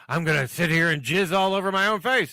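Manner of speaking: hammed-up shout, high and very loud; mock shout. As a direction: belt it out like an absurd boast. Repeats crude quote louder, over effect.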